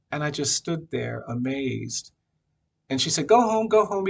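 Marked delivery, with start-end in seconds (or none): 3.3-4.1 higher, louder shoo; shooing him home. Retold or imitated. Older woman shooing Brian home, high and firm.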